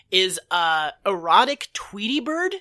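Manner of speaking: normal talking voice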